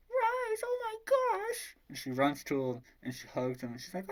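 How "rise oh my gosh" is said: very high falsetto squeal of relief; hammed-up woman crying out at a reunion; overjoyed cry.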